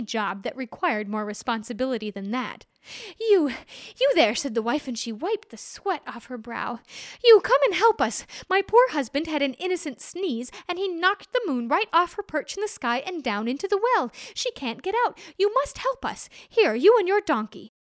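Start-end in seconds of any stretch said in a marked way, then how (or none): 3.2-4.3 high, loud hail; calling out. Acted. Wife hailing the simpleton, loud and high. 7.2-17.7 high, loud insistent command; urgent order. Acted. Wife ordering the simpleton to help, urgently loud.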